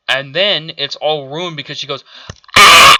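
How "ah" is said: short loud mocking scream of Reva. Mocking Reva's scream while retelling the scene, loud yelp. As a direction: yelp it out like a startled girl. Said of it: "and screams".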